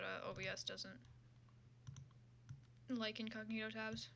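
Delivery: ordinary delivery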